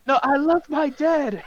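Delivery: very high, drawn-out mock protest; imitating Harry. Son defending his imprisoned father, high mock wail. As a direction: wail it high and slow, hammed up. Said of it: "Harry's like".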